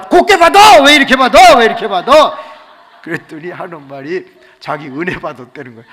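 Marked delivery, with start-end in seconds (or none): 0.0-2.4 high, very loud mock outburst; exasperated scolding. Pastor scolding girl who scared him, loud exasperation. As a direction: tell off at full volume after a fright.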